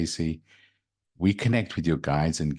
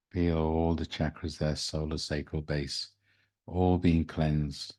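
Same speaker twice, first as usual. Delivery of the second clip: guided meditation. Acted. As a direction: guide them into calm, gently.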